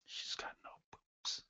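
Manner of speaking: hushed, whispered punchline; whispered aside. Pretend. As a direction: slip it in under your breath. Joking aside under his breath.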